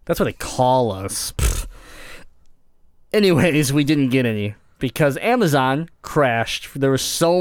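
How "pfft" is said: a scornful pfft of breath; dismissive pfft. Real, not acted. Dismissing the Midwest label.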